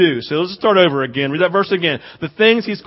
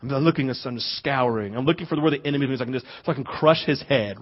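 low, soft, as if on guard; hushed watchfulness; 5 semitones lower, 8 dB quieter than usual. Acted. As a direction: murmur like a lookout scanning for the enemy. Acts out calm watchful alertness.

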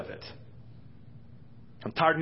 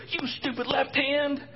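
much higher and louder, hammed-up scolding; mock angry body part; 8 dB louder than usual. One hand yelling at the other over a lost game, mock fury much higher and louder. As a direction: berate the hand like a sore loser. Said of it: "this doesn't go".

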